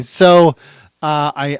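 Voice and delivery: ordinary delivery, nothing marked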